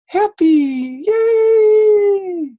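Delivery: very high, loud, long-held yay; gleeful cheer. Pretend. Teacher celebrates sharing with a friend, gleeful cheer. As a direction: sing out a big children's cheer.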